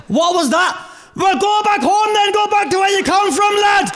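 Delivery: very high, hammed-up yelling; strained high-pitched mock fury; mock rant. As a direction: yell like an outraged yob, played for laughs. Rehearsing yelling at refugees to leave.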